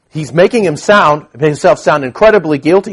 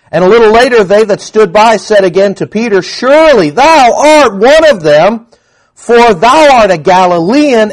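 accusing bystanders; 6 semitones higher, 4 dB louder than usual. Acted. Crowd accusing Peter, read dramatically.

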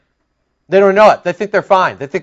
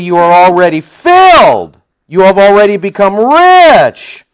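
loud, high mocking sarcasm; sarcastic; 4 semitones higher, 8 dB louder than usual. Paul taunting the Corinthians, loud sarcastic mockery. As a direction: sneer it like a taunt. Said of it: "He says sarcastically".

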